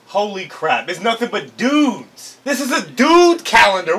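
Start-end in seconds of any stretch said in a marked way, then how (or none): none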